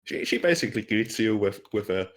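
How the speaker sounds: normal talking voice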